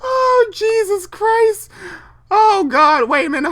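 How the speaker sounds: laughing. Real, not acted. Cracking up at fanfic.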